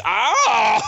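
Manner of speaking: sudden high mock scream; imitated earpiece yelp. Retelling the earpiece going off, sudden mock yell. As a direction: burst into a startled-pain yelp. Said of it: "all of a sudden his earpiece".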